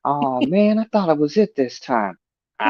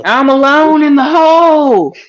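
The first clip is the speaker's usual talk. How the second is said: shrill hillbilly yell; 11 semitones higher, 6 dB louder than usual. Retold or imitated. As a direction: holler it from down in a hole. Quoting movie line, high loud.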